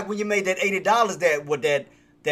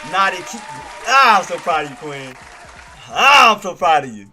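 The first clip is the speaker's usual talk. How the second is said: bursting with loud, happy pride; real joy, almost shouting; overjoyed; 9 dB louder than usual. Real, not acted. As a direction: cry out your pride in her. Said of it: "I'm truly happy".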